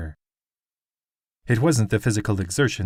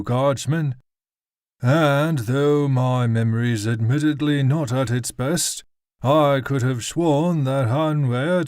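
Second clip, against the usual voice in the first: put-on slow, haughty drunken lord; haughty nobleman; 4 semitones higher, slower than usual. A narrator's reading. Nobleman rebukes the guardsman.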